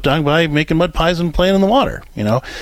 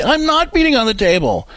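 put-on much higher, whiny child denying it; protesting kid; 10 semitones higher than usual. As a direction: play the kid insisting he's innocent. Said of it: "And they say".

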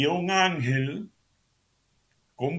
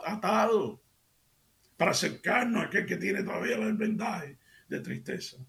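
raised, higher-pitched sermon climax; fervent preaching; 7 semitones higher than usual. Real, not acted. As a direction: lift the congregation with conviction. Preaching at rising force. (predicador ante la radio, con fervor elevado)

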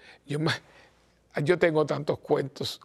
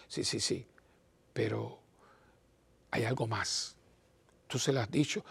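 soft pressing; 5 semitones lower, 8 dB quieter than usual. Retold or imitated. Priest presses husband softly.

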